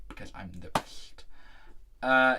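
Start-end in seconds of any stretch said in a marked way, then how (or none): 0.1-1.2 cheeky little whispered boast; whispered aside. Real, not acted. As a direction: drop to a whisper, as a sly aside.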